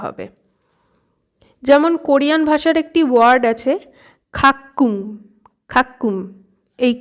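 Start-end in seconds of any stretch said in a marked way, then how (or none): 4.3-6.1 খাক্কুম with a tense, held kk; doubled ক. Shown as a demonstration. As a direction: hold the middle of খাক্কুম, then release k. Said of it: "যে উচ্চারণটা করছি কয় কয়". (খাক্কুম-এ জোড়া ক)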